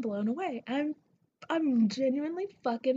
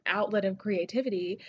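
usual speaking voice

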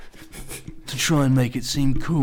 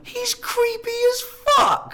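exaggerated disgust; slower than usual. Real, not acted. Mock horror at Leto.

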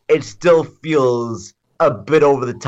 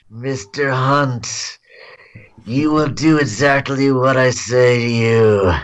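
slow, lightly voiced movie villain; a hint of a menacing bad guy; cliche evil villain voice. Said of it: "he has the most cliche evil villain voice".

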